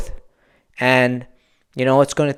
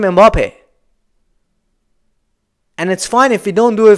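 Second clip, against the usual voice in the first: raised, higher voice, venting about the team; frustrated rant; 6 semitones higher, 4 dB louder than usual. Real, not acted. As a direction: let the frustration spill out, pushing louder. Frustrated fan ranting about the match.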